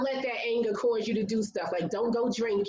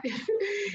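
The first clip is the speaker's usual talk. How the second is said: a short high burst of laughter; sharp high laugh; 12 semitones higher than usual. Real, not acted. Mother recalling her daughter, a sudden high laugh.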